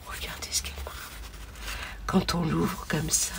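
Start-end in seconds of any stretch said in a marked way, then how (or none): 0.1-0.9 hushed, breathless whisper to viewers. Real, not acted. Whispering to viewers over the gift, awed. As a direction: breathe the words in awe.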